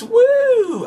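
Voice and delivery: high loud whoop of delight; excited whoop. Real, not acted. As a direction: let out a happy whoop. Excited unboxing.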